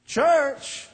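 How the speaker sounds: high, loud scoffing disbelief; scoffing young man. Retold or imitated. Young man in the locker room mocks the church invite, high and scoffing. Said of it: "and he said".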